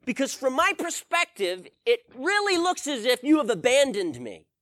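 high, loud pleading lament; a man crying out to God in frustration; desperate complaint to God. Retold or imitated. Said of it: "I just began to pour out my complaints to God".

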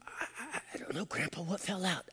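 awed grandchild. Retold or imitated. Grandkid answering Grandpa's riddle, hushed awe. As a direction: imitate a spellbound child, hushed and hesitant. Grandkids' breathless reply acted out.

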